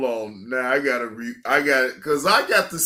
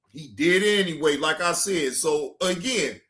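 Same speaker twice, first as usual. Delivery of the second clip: ordinary talk, nothing marked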